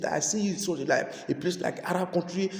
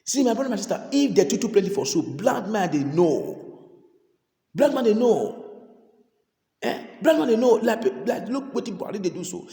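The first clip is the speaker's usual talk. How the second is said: raised, loud pleading sermon; urgent call to repent; fervent preaching; 6 semitones higher, 4 dB louder than usual. Real, not acted. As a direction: press the congregation hard. Preaching at full force.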